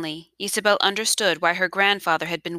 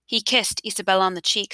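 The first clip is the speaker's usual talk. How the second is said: plain reading voice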